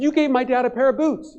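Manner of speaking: high-pitched, eager outburst; excited recollection. Retold or imitated. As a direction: share the memory with delight.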